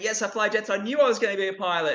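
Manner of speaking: put-on high, tumbling child's voice full of excitement; a thrilled kid bragging that he flies jets; excited boy. Boy excitedly telling his grown-up self he is a pilot. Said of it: "just getting all excited".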